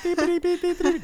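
very high beeping imitation of a telegraph; mock telegraph signal, high and chirpy; telegraph beeps. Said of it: "imitating a telegraph".

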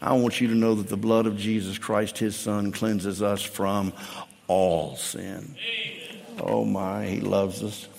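low, soft pastoral appeal; low, slowing, reverent delivery; hushed preaching. Acted. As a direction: let the preaching drop low and tender.

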